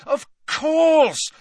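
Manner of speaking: high, loud exclamation; ringing shout of realisation. A narrator's reading. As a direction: cry out as it all clicks. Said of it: "said Harry loudly".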